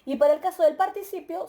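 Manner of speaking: ordinary talk, nothing marked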